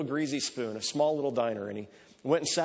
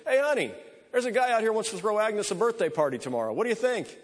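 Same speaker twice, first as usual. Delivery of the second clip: much higher and louder, calling out; hollering to the kitchen; 8 semitones higher, 3 dB louder than usual. Acted. Cook hollering to his wife in the back, loud call. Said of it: "he hollers back to his wife".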